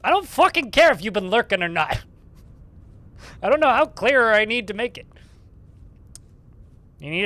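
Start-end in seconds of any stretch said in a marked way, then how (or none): 0.0-5.1 loud, high, laughing rant; exasperated yelling at chat. Real, not acted. Streamer yelling at chat after hours hunting clay, loud and laughing. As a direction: blow up at chat in real frustration, half laughing. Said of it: "Apologies for my inappropriate outburst".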